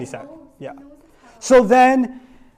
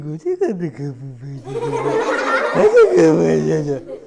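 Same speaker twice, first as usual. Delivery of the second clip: put-on king cooing at baby; 3 semitones lower than usual. Mean king melts at baby.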